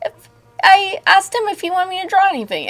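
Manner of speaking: a squeaky sped-up thought; high quoted thought. Retold or imitated. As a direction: quote yourself in a high, fast voice. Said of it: "quoting her own thought".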